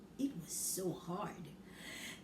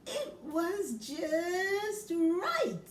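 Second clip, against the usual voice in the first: very slow, higher and louder just right; slow contented; 7 semitones higher, 4 dB louder, much slower than usual. Acted. Reader to child, slow delighted just right. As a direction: drag it out happily.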